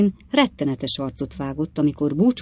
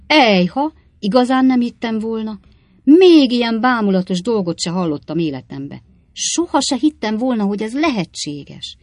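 astonished, higher and louder outbursts; amazed exclamations; 5 semitones higher, 5 dB louder than usual. A narrator's reading. Innkeeper interrupts the one-eyed man's tale, amazed. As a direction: marvel out loud in disbelief. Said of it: "egyéb ilyen álmélkodó kifejezések".

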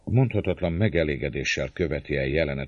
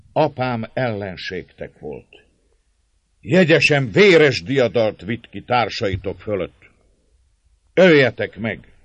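defiant; 7 semitones higher, 11 dB louder than usual. A narrator's reading. Captive lady defies her enemies.

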